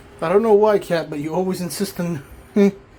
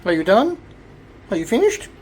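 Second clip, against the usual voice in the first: talking to a cat; 5 semitones higher than usual. Real, not acted. Asking the cat.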